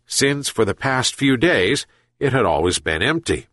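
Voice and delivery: plain reading voice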